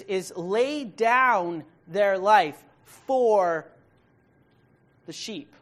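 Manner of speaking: "for" stretched long and loud, set off by a pause; stress on FOR. Shown as a demonstration. Preacher showing where a verse's emphasis falls, stress on FOR. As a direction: lay it down slowly, landing hard on "for". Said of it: "the emphasis is on the word for".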